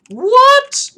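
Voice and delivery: very high, loud squeak of disbelief; real stunned outburst; astonished what. Real, not acted. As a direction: shriek it in shock. Disbelief at Bruno's return.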